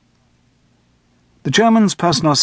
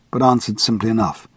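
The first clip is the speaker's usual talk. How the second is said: usual speaking voice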